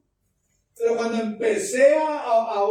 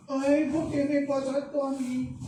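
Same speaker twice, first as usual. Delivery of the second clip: higher, put-on woeful voice; mock self-pitying wail; 5 semitones higher than usual. Preacher mimics the one who fell into the abyss, mock wailing. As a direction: imitate someone bemoaning their bad luck. Voices fallen person bemoaning their fate.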